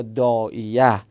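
"داعیه" drawn out, ending in -ya; fatha ending. Shown as a demonstration. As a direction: say "داعیه" slowly as daa-i-ya. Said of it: "روش فتحه ظاهر شده".

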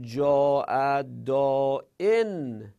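very slow, deliberate recitation of Arabic examples; slow example reading. Shown as a demonstration. Teacher reciting grammar examples to students, slow and drawn out. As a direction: draw each word out for the class.

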